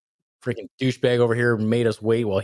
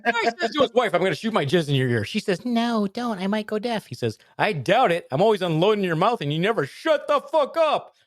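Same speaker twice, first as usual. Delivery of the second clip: much higher, faster joke read; silly high voice; 10 semitones higher than usual. Pretend. Retelling the joke badly, in a squeaky high voice. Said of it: "try to sound not like you".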